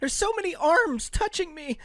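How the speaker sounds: high whiny victim; mock victim voice. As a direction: whine it in a high voice.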